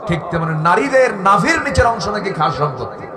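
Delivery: high, loud, outraged preaching; indignant denunciation. Real, not acted. Preacher denouncing filth to the crowd, indignant shouting. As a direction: cry out in outrage at the scandal.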